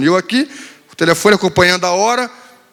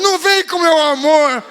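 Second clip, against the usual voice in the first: sulky daughter retort; 9 semitones higher, slower than usual. Retold or imitated. Daughter rejecting father's affection.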